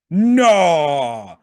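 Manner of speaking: loud, drawn-out refusal; loud disbelief. Retold or imitated. Fan reacting to a hero's heel turn, loud disbelief. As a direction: blurt it out long and loud in shock.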